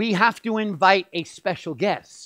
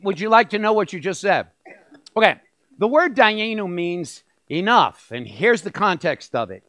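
ordinary delivery

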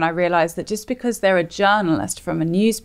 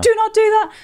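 high, loud inner warning voice; gut voice; 5 dB louder than usual. Pretend. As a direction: squeal it like a panicked gut. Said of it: "your gut is just like".

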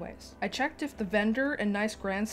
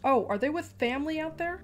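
charge nurse; 7 semitones higher than usual. Acted. Charge nurse voiced, high.

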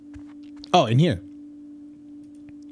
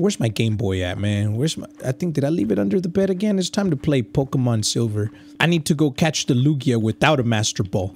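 ordinary talk, nothing marked

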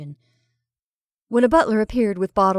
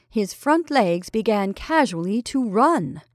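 ordinary delivery

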